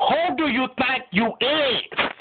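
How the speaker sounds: high, drawn-out who do you think you is; slow indignant challenge. Acted. God challenging Lucifer, slow and indignant. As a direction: lean on every word.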